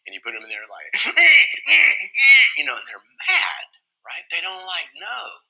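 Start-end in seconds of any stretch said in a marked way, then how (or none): 1.0-2.5 loud, high burst of laughter; big laugh. Real, not acted. Father laughing at his toddler's timeout, loud burst of laughter.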